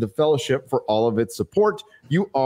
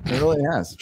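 ordinary delivery

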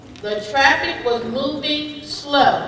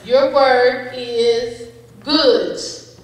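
slow pronouncer voice; 3 dB louder, much slower than usual. Acted. Spelling bee pronouncer reading word.

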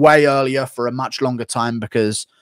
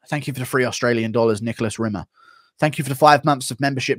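ordinary talk, nothing marked

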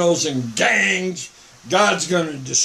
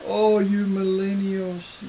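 normal talking voice

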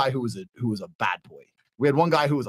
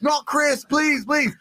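high, slow drawn-out mock panic; panicking; 10 semitones higher, much slower than usual. Client watching on camera, panicking, high slow wailing plea. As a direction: wail it like he's losing his best friend. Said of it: "like, panicking".

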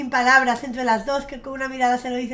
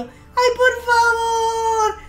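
slow, high, loud tearful cry of delight; teary gushing; 9 semitones higher, 8 dB louder, slower than usual. Real, not acted. Streamer tearing up at the sweet nest scene, high slow cry. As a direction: weep happily over the little nest. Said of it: "Ya estoy llorando".

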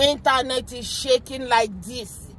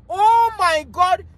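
slow, high, louder wail of disbelief; mock horror; 4 semitones higher, 5 dB louder, slower than usual. Critics appalled he said president, mock horror. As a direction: draw it out as if scandalized. Acted shock at calling him president.

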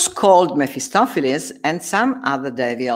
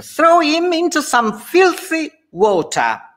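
much higher, sneering order; cruel command; 14 semitones higher, 4 dB louder than usual. Acted. Faustus ordering devils to punish Benvolio, cruel. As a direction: relish the cruelty.

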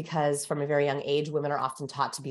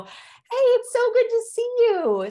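hammed-up, very high and loud phone opener; top-of-breath greeting; 7 dB louder, slower than usual. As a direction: imitate an overexcited, held-high greeting. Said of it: "the highest part of the entire call".

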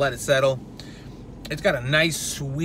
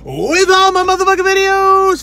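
high, loud metal-style scream; 11 dB louder than usual. Acted. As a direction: belt it out like a metal frontman. Channel intro, metal band guy.

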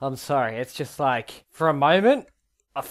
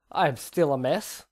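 ordinary talk

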